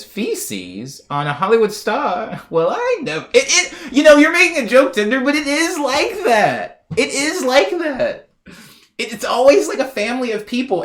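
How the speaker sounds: high-pitched, slowed, emphatic rant with a laugh; excited rant. Real, not acted. Streamer ranting to chat about Hollywood, squealing high. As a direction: wind up into an outraged squeal.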